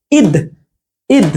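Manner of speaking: ঈদ with a short, quick first vowel. Shown as a demonstration. Teacher showing students the short-i spelling, ঈদ said short. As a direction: say ঈদ briefly, vowel cut short. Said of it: "এরকম বলবো".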